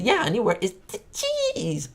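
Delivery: high-pitched quote with a long CHEESE; hammed-up TV husband, rising high on CHEESE; Ricky impression. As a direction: imitate him, stretching CHEESE out high. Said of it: "that episode where he said".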